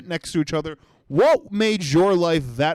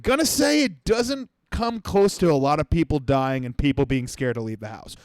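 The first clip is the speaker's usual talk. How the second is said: ordinary talk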